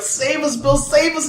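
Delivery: high-pitched, hammed-up plea for rescue; mock pleading. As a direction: beg like a pretend damsel in distress. Acting out public pleading with returning hero.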